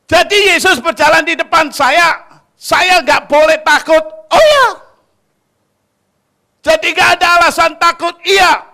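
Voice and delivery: loud, high sermon shouting; preaching at full force. Acted. Preacher shouting to the congregation, fervent and loud.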